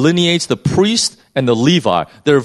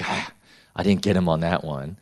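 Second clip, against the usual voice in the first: mock muttered grumble; 6 semitones lower, 8 dB quieter than usual. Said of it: "the lawyer is red-faced".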